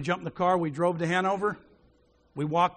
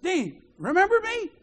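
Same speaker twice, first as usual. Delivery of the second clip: high-pitched, raised hail; eager calling out; 11 semitones higher, 4 dB louder than usual. Retold or imitated. Sick man greets Dean across the foyer, calling out. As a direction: call out high to an old schoolmate. Old schoolmate hails Dean again.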